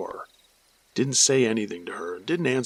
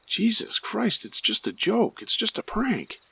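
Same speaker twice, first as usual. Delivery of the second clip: retold attacker's excuse; 10 semitones higher than usual. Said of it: "The voice then said".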